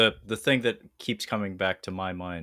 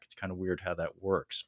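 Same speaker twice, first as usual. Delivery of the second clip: ordinary delivery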